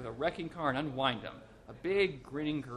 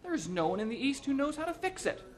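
much higher, urgent alarm; pitched-up frantic intern; panicked cry; 11 semitones higher, 3 dB louder than usual. A narrator's reading. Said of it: "shouted an intern".

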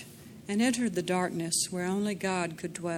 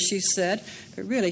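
ordinary delivery

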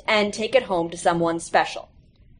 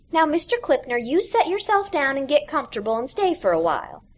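higher homely hostess voice; welcoming mother; 6 semitones higher than usual. A narrator's reading. Audie's mother welcomes Mr Clipner to sit down, homely. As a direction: invite him in like a kindly mom.

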